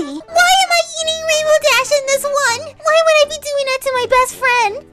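high, loud wail of dismay; upset cartoon pony outburst; horrified protest. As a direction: cry out, appalled at what you see. Horrified at picture of eating friend.

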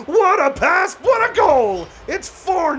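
high, loud, ecstatic shout; real joyful goal call; goal celebration. Real, not acted. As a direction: yell it like the crowd just erupted. Celebrating his team's goal.

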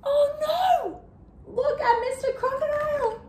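gasp, then high-pitched panicked warning; storyteller's high, pretend fright; mock alarm. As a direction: urge the victim to flee in alarm. Narrator reacts to crocodile's threat.

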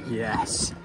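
normal talking voice

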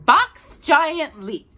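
usual speaking voice

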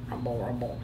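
low, hushed rumbling words; tummy rumble. Acted. Reader voices the whale's hungry tummy, low rumble.

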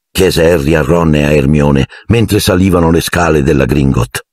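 ordinary delivery, nothing marked

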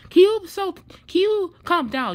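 loud, high, slowed-down scolding; exasperated partner yelling; angry outburst. Acted. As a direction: tell him to calm down, loud. Couple quarrel over lost phone.

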